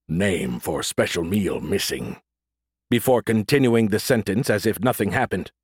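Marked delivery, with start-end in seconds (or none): 0.1-2.2 low, slow robotic error message; flat machine system readout; monotone. Acted. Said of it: "in a monotone said".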